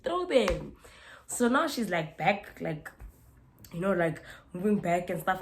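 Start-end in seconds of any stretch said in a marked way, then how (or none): 0.0-0.7 high, loud retold taunt; daring challenge. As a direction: egg her on, loud and high. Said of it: "That's how I literally said".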